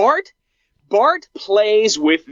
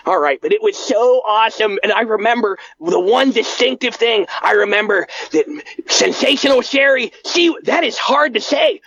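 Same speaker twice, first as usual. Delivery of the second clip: higher, eager recollection; Bart voice; 4 semitones higher than usual. Acted. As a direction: remember your first show with glee. Bart continues story.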